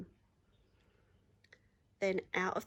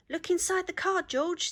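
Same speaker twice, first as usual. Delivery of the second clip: much higher, louder bright urging; eager animals; 9 semitones higher, 5 dB louder than usual. Acted. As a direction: coax George eagerly to look. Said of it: "said the animals".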